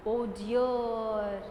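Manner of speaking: low, drawn-out lament; mild dismay. Acted. Teacher voices girl seeing the brown garden, mildly dismayed. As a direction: sigh it out low and long, lightly acted. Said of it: "Now she is saying like".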